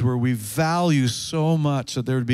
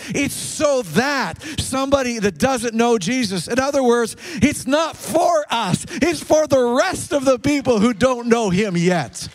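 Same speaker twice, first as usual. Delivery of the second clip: raised high, emphatic preaching; preaching climax; 9 semitones higher than usual. Real, not acted. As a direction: press the point home with force.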